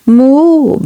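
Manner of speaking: a cow's loud, high moo; bellowing cow. A narrator's reading. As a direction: bellow it like a cow calling her calf. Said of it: "bellowed Bluebell".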